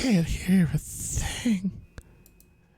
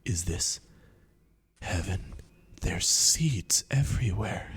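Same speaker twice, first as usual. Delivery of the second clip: whispered in wonder at the seeds; awed whisper; 7 semitones lower, 5 dB quieter than usual. Acted. Bird waking in heaven whispers to itself, awed. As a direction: breathe it out in awe.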